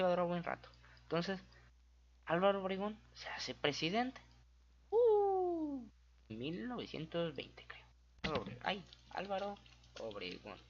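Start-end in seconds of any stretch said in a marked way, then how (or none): 4.9-5.9 high squeal. Real, not acted. Sudden squeal before the date.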